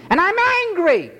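I'm angry flung out high-pitched; high angry cry. Real, not acted. Preacher repeats his anger.

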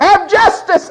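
high shouted demand with a laugh; hammed-up shout for justice; mock demand. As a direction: bark it at heaven like a spoiled demand.

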